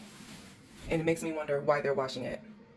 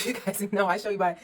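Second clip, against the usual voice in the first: ordinary delivery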